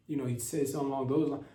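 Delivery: ordinary delivery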